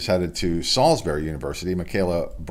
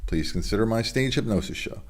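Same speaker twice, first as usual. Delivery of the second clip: sponsor ad read; 4 semitones higher than usual. Acted. Host plugging his own hypnosis show to viewers, upbeat ad read. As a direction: sell the hypnosis show like a radio spot.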